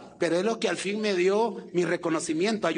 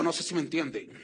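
normal talking voice

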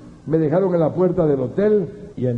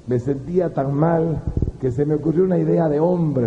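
ordinary talk

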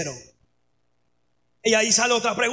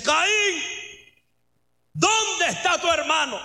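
high, drawn-out booming call from God; God's potent voice; 6 semitones higher, slower than usual. Acted. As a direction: call out as God, powerful and commanding. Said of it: "Dios con su voz potente le dice".

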